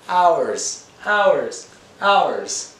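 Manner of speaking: each "hours" high and loud, falling; emphatic. Real, not acted. Teacher stressing time spent learning Spanish, emphatic. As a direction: hammer every HOURS, high then dropping. Repeating to show long effort.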